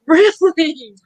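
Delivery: high, loud "Really?"; shocked surprise. Retold or imitated. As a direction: act stunned and thrilled. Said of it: "I'm like".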